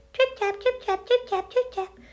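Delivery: high, light tripping footsteps; dainty high trip tap; light little trip tap. A narrator's reading. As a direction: tiptoe the sound across like a tiny goat. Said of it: "his feet made a little bit louder noise".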